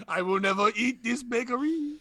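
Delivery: high, haughty mock Frenchman; French accent. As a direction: declare it in a put-on French accent. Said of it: "in French, you could just do that".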